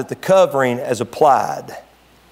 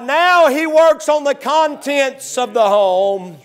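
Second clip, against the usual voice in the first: full-force preaching; 9 semitones higher than usual. Acted. Pastor rousing the church about the blood, preaching at full force. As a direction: preach it hard to the congregation.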